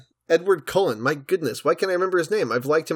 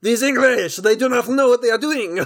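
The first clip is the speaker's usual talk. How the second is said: higher, hammed-up cartoon French accent; cartoonish French accent; 7 semitones higher than usual. Critic mocking a cartoon Frenchman, hammed-up higher French accent. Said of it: "the most cartoonishly French".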